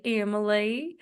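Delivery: "Emily" stretched to "Em-uh-LAY"; imitating "Emily" said as "Emma-lay"; friend's drawn-out "Emily". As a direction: end "Emily" on a long rising "lay". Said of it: "and she's like".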